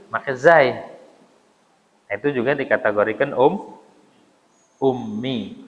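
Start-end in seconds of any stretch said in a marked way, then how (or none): none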